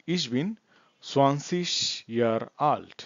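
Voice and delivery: very slow, word-by-word German; slow language demo. Shown as a demonstration. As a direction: space each word out for learners. Teacher repeats the model answer. (শব্দে শব্দে খুব ধীরে)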